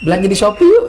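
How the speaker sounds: lightly voiced celebrity ad invitation; teacher imitating a celebrity's short shopping ad; Andin ad line. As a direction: say it like a sweet TV ad star, mildly. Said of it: "kata siapa? Andin".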